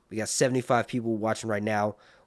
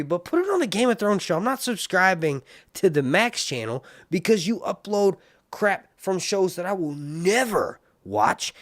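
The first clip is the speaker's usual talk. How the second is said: high-pitched, louder complaint; scolding, worked up and much higher than usual; exasperated rant; 9 semitones higher, 4 dB louder than usual. Real, not acted. Streamer ranting at HBO for uploading elsewhere, exasperated. Mock rant at the channel owners.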